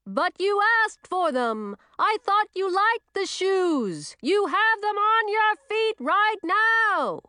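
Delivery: high, slow, loud witch voice; booming high-pitched insistence; bellowed protest. A narrator's reading. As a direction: protest at full volume, high and drawn out.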